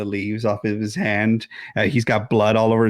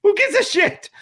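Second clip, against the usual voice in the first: scoffing; 3 dB louder than usual. Real, not acted. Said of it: "ranting at the film".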